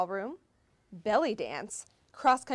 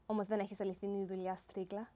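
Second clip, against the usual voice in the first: soft, quick Greek jab; mock aside in Greek; muttered Greek insult; 3 semitones lower, 8 dB quieter, faster than usual. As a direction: sneak an insult past her in Greek.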